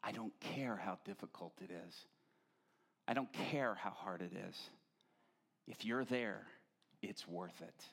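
soft, low, tender prayerful voice; quiet earnest plea; hushed devotion. Acted. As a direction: speak it softly like a prayer to God.

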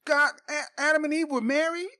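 incredulous. Real, not acted. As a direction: push the disbelief up and out. Arguing Adam and Eve marriage.

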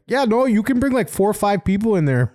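high, bright mock receptionist; imitating Canadian staff. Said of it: "they're probably like".

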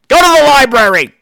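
loud, high jokey order; mock scolding shout. Real, not acted. Reviewer scolding viewers who missed the joke, loud mock shout. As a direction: bark it at the clueless viewers.

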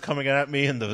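ordinary delivery

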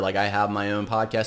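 normal talking voice

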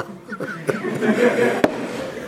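high, airy laughter; amused laugh. Real, not acted. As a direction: crack up at your own story. Laughs at own story.

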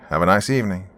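usual speaking voice

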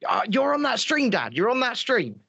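retold high-pitched exclamation in an Australian-free, everyday voice; high, mock-excited call to dad; excited son. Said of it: "got in contact with him and went".

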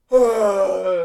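very loud, long hammed-up "aah"; mock wail. Teacher playing disruptive pupil across desk, mock wail. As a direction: interrupt with a dramatic drawn-out cry.

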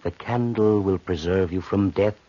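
ordinary delivery

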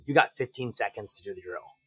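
low, soft, measured threat; deadpan ultimatum. Retold or imitated. Instructor giving a shooter the drill's penalty, low deadpan delivery. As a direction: lay down the stakes quietly.

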